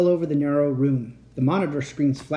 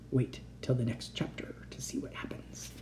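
whispered sign-off; 2 semitones lower, 12 dB quieter than usual. Real, not acted. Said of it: "closing words to listeners".

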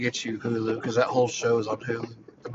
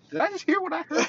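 pitched way up in disbelief; can't believe his ears, squeaky high; incredulous high exclaim; 3 dB louder than usual. Retold or imitated. To the singing lady on the intercom, high incredulous exclaim. Retelling a startled question.

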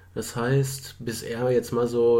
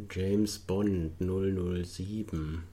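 slow pondering; 5 semitones lower, 7 dB quieter, much slower than usual. Real, not acted.

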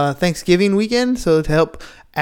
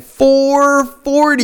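high, slow amazed echo; surprised; 9 semitones higher, 3 dB louder, much slower than usual. Real, not acted. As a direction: savor it in disbelief. Amazed at early wake-up.

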